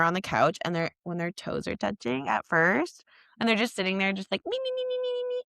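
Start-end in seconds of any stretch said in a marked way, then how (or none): 4.5-5.4 tiny squeaky repeated syllables, far above usual voice; high-pitched silly mimicry. Imitating the pair sitting on the couch, squeaky mimicry. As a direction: chirp it in a very high mock voice.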